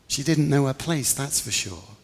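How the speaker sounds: usual speaking voice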